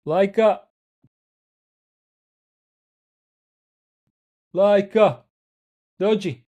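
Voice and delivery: loud, high call across the room; calling the dog. Real, not acted. Streamer calls his dog Laika to come over, loud high calling. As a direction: call her name out to fetch the dog.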